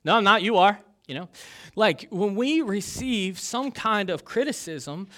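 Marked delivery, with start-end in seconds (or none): 0.0-0.8 put-on loud, higher sing-song kid retort; childish comeback. Congregation hears a kid's defensive retort, loud and childish. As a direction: throw it back like a kid on the playground. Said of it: "We learn it when we're kids".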